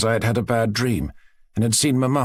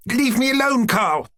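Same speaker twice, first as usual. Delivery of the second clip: tearful outburst; 13 semitones higher than usual. Acted. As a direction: burst out at him, close to tears. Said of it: "I exclaimed at length, with tears in my eyes".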